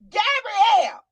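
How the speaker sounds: playful country yell, high and loud; back-road holler. Pretend. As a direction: call the name out across a back road. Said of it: "I'm way from some old back road".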